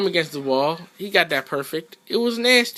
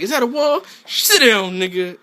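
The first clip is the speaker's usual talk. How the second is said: higher, louder trash talk; quoting himself; 7 semitones higher, 5 dB louder than usual. Retold or imitated. Gamer voicing his finishing taunt, higher louder trash talk. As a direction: slam it home with a taunt. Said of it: "I was like".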